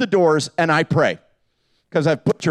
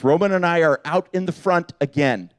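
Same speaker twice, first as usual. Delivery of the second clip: normal talking voice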